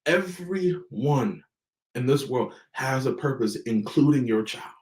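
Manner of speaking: Everyone drawn out slow, word by word; slow right down on Everyone so it lands; deliberate, slow emphasis on Everyone; said slowly. Shown as a demonstration. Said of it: "I'll say it slowly".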